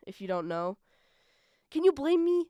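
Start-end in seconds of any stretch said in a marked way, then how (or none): 1.7-2.4 playful excited question. Real, not acted. Playful rhetorical question to listeners.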